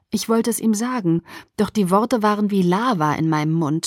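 plain reading voice

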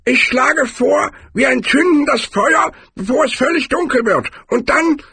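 high-pitched barbarian making a suggestion; Cohen. A narrator's reading. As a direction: propose it in a high, squeaky character voice. Cohen proposes making a fire.

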